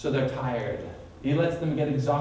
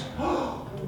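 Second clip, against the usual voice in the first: sharp gasp; 6 semitones higher, 7 dB quieter than usual. Acted. Frightened disciples see a figure.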